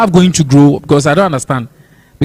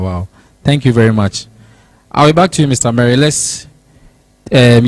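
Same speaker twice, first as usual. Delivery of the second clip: usual speaking voice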